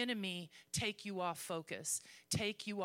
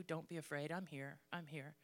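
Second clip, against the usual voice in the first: softer, gentle comforting voice; calm frightened friends softly; a quiet, reassuring Jesus; tender reassurance; 5 semitones lower, 7 dB quieter than usual. Acted. Voicing Jesus comforting disciples.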